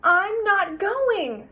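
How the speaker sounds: put-on stubborn child. Sarah refusing her father from under the covers, slow and stubborn. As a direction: dig in and refuse.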